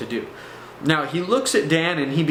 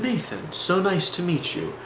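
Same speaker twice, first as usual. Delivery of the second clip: lightly voiced guru, a little higher; guru talk; 4 semitones higher, 3 dB quieter than usual. Guru greeting a new visitor with a hug, lightly voiced guru talk. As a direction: greet softly, with a hint of guru. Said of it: "what I call the guru talk".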